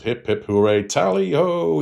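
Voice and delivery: high, excited cheer of a host at a hunt; gleeful shout. Retold or imitated. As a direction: cheer him on like a gleeful toff. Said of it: "he shouts".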